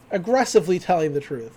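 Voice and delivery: ordinary talk, nothing marked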